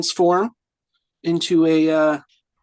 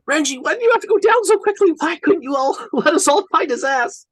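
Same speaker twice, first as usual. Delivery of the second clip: exasperated whine; 9 semitones higher, 4 dB louder, faster than usual. Real, not acted. Lamenting fallen ally, exasperated.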